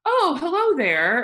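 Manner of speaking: high, loud, drawn-out surprised hello; startled greeting. Retold or imitated. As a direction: imitate a startled man greeting strangers. Client suddenly greets unseen squatters.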